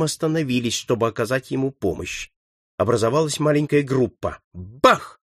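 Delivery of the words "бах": sudden punchy bang; imitated gunshot. A narrator's reading. Narrator sounds out the marksman's shot, imitated bang. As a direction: voice a rifle shot. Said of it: "то снова выстрелил Сорвиголова".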